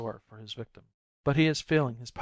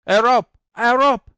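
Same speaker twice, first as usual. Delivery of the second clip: much higher, much louder frantic scream; 12 semitones higher, 13 dB louder than usual. A narrator's reading. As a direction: scream for a rope. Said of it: "she screams".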